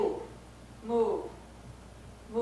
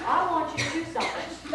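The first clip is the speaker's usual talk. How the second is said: put-on comic Southern accent, pitched up; Southern-voiced God; 5 semitones higher, 4 dB louder than usual. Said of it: "he talks in a southern voice when he talks like this".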